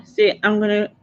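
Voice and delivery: ordinary talk, nothing marked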